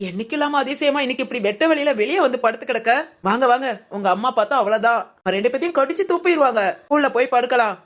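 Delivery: normal talking voice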